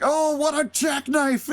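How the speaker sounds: high, slow, dragged-out announcer gush; Vince McMahon impression. Mocking McMahon's call of the botched move, in a high, slow gushing voice. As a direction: stretch it out like a dazzled commentator. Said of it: "McMahon is like".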